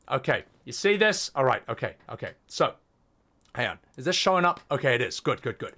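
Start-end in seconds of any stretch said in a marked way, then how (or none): none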